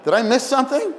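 high, loud confused husband; mock baffled husband. Husband baffled by his wife's demands, loud and high. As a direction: play the husband lost and puzzled. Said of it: "he has like".

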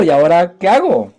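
¿y ahora qué hago? pushed up high and loud. Retold or imitated. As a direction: ask it again, bursting with new excitement.

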